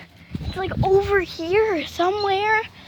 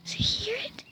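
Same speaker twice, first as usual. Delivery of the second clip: hushed whisper, barely any voice; 5 semitones higher, 10 dB quieter than usual. Real, not acted. Whispering to viewers about a hidden cat. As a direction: whisper so the cat won't run.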